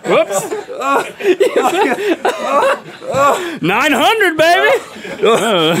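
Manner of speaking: high laughing brag. Real, not acted. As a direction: laugh through the boast. Laughing after hit.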